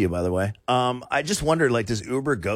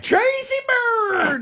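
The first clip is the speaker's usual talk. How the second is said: very high, louder mock squeal of the name; squeaky name; 3 dB louder, slower than usual. Host giving the scam-proof answer in a high squeal.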